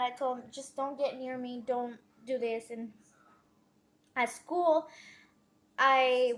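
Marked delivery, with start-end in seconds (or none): none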